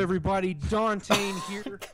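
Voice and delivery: punchy YouTuber intro; Dante voice. Shown as a demonstration. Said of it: "Let's have a radio voice".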